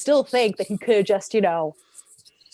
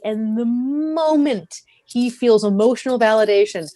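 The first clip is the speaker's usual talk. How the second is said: normal talking voice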